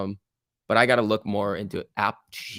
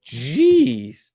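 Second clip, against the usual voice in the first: high, loud, stretched-out jeez; stunned jeez; 11 semitones higher, 8 dB louder than usual. Real, not acted. Trader watching a stock spike, high stunned jeez. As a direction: blurt it out at the sudden spike.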